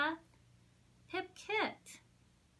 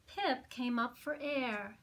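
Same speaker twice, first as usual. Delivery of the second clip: ordinary delivery, nothing marked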